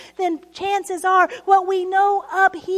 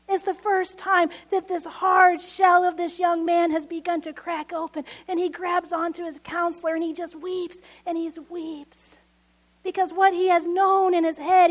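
ordinary talk

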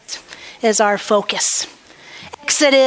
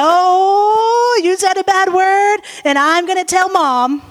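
higher, sing-song tattletale voice; taunting big sister; 6 semitones higher than usual. Retold or imitated. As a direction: tease him like a gloating nine-year-old. Nine-year-old sister taunting.

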